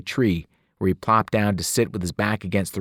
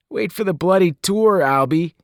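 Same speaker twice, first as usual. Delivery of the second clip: put-on higher, louder British-accented teasing; playful Brit cutting in on his friend; thick British accent; 10 semitones higher, 5 dB louder than usual. Newt teases Albie about the tour, thick British accent. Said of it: "his voice thick with an odd accent".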